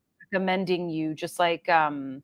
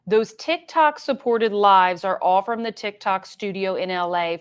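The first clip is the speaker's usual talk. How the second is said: usual speaking voice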